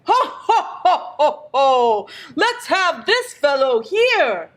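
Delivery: hearty ho-ho laugh into a jovial call; Santa Claus. A narrator's reading. Picking a child from the crowd, jolly Santa voice. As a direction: boom out a merry chuckle, then pick someone. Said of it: "and Santa Claus appeared".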